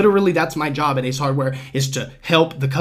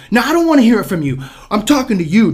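higher, louder, fast cutoff; angry customer; 8 semitones higher, 7 dB louder, faster than usual. Retold or imitated. Customer cutting off the clerk to address the manager, angry and fast. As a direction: snap at him to stop talking.

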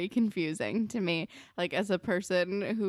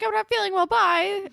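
high, loud pretend excuse; mock hasty excuse; 13 semitones higher, 9 dB louder than usual. Suggesting a better lie for Marco, mock hasty excuse.

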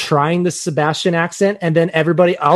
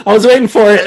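laughing; 6 semitones higher, 9 dB louder than usual. Real, not acted. Laughing at crabs joke.

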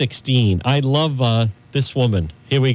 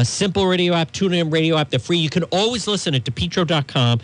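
ordinary talk

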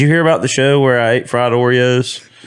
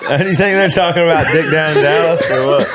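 laughing; 6 semitones higher than usual. Real, not acted. Laughing at pickup line.

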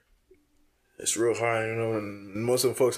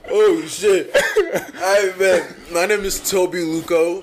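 higher and louder, laughing while talking; laughing through it; 6 semitones higher, 6 dB louder, much slower than usual. Real, not acted. Student reintroduces himself to the camera while still laughing. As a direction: try to talk through the laughter.

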